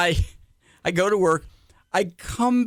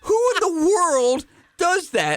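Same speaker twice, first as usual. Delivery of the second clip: much higher, amazed question; can you believe it; host aghast at the twenty extra guests; incredulous cry; 11 semitones higher, slower than usual. Real, not acted. Outrage at guest list.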